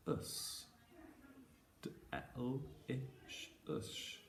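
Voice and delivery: soft letter sounds of delicious one by one; quiet segmented sounds; sounding out. Shown as a demonstration. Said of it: "Let's try our next one".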